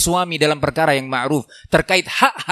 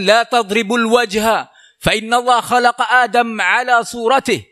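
quoting the Prophet; 6 semitones higher, 3 dB louder than usual. Retold or imitated. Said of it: "Nabi mengatakan".

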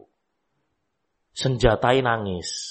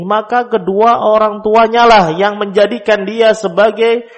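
fervent preaching; 9 semitones higher, 7 dB louder than usual. Acted. Preacher proclaiming the hadith to the parents, loud and fervent. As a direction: proclaim the hadith with full force.

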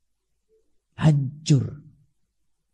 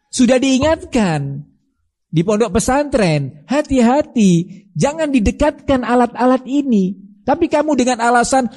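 pitched-up pulpit rebuke; scolding rebuke; 8 semitones higher than usual. Acted. Preacher scolding the parents.

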